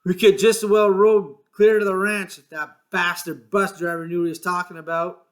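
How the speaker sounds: bitter grumble about the bus driver; angry. A narrator's reading. George cursing the bus driver, angry. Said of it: "He said angrily".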